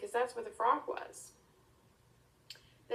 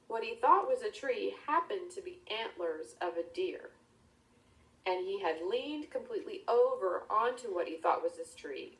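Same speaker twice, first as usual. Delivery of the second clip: ordinary delivery, nothing marked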